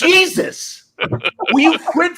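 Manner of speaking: high-pitched, exasperated scolding; exasperated outburst. Real, not acted. Host scolding co-host over virtual background, exasperated. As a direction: cry out in fed-up disbelief.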